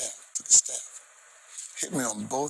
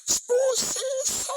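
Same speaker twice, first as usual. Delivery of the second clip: an even higher, stretched-out scream of "Spusiso!" into the mic; retold scream; 3 dB louder, much slower than usual. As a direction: shout the name across the stage. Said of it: "and screams".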